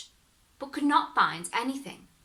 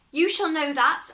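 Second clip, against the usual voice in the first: high, loud sure promise; confident promise; 7 semitones higher, 6 dB louder than usual. Acted. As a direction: declare it with full confidence. Hero promises.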